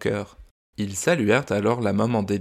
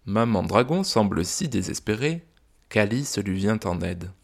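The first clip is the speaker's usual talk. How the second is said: ordinary delivery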